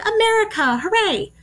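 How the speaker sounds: high, mock-patriotic cheer. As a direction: cheer it like a proud parade-goer. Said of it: "it's like".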